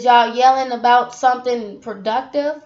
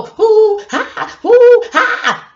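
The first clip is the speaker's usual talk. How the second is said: high, loud hooping preacher grunts; mock preacher hoots; 10 semitones higher, 11 dB louder, much slower than usual. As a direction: imitate an old-school pastor's hoo-ha whoop. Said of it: "the pastor go".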